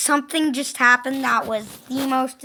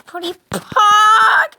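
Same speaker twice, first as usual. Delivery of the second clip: loud, high, slow cry turning into a laugh; anguished shout; 10 semitones higher, 10 dB louder than usual. Real, not acted. Gamer loses right after cheering, anguished shout. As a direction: yell in despair then break into laughter.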